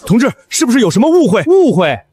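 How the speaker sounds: fast, agitated protest; indignant challenge. Acted. Accuser challenging an inspector about vet licences, indignant. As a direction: argue the accusation with rising indignation. 举报人当场争辩质问.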